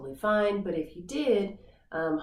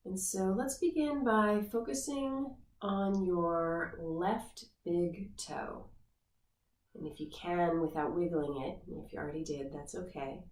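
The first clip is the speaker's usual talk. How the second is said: guided meditation. Acted. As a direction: ease the listener into the body scan gently. Leads guided body scan meditation.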